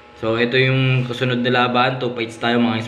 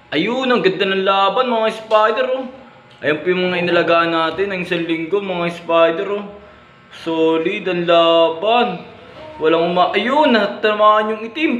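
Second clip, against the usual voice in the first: excited play-by-play; 8 semitones higher than usual. Real, not acted. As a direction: cheer the spiders on, getting carried away. Spider fight getting intense. (masiglang pagko-komentaryo sa laban)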